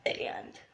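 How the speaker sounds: burped word; talking while burping. Shown as a demonstration. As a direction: burp out and. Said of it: "That was and".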